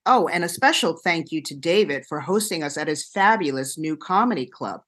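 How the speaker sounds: ordinary talk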